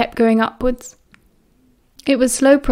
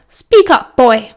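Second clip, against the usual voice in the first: barked order; 5 dB louder, slower than usual. A narrator's reading. Mother ordering her son to speak up, barking. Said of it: "she barked".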